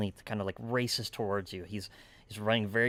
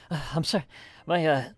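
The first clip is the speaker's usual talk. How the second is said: higher, louder, halting and short of breath; winded farmer; 6 semitones higher, 8 dB louder, faster than usual. Acted. Winded farmer apologizing to his helper, breathless halting voice. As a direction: apologize while still catching your breath. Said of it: "catch his breath, right?".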